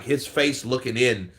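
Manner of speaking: ordinary talk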